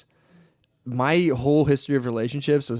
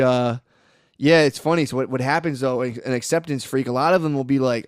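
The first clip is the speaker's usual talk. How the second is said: normal talking voice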